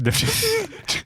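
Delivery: high laugh; real laugh. Real, not acted. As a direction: laugh at your own slip. Laughs at her own slip.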